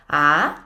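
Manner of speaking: "a" starting low and sweeping sharply up; questioning, rising "a"; rising second tone. Shown as a demonstration. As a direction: ask "a" like a question. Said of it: "will sound like a question".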